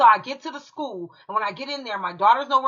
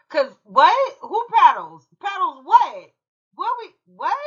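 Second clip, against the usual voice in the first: stunned disbelief; 6 semitones higher, 5 dB louder, slower than usual. Retold or imitated. Retelling shock at paddling news.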